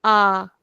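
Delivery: A said with the mouth wide open and held long; stretched open A. Shown as a demonstration. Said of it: "open our mouth very wide".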